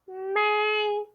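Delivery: "May" held long on a high, level pitch; first tone. Shown as a demonstration. Teacher showing students what first tone is, high level "May". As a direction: sustain "May" high and steady. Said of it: "What is first tone?".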